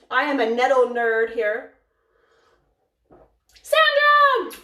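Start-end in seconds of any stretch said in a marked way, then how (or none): 3.6-4.6 high, loud squeal of a name; much higher, louder, gleeful shout; excited greeting call. Real, not acted. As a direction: call out delightedly to a friend just arriving. Calling out greeting to a viewer.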